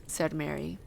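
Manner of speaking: ordinary delivery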